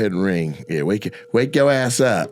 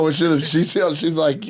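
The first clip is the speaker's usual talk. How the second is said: normal talking voice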